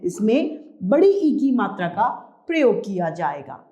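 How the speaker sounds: ordinary talk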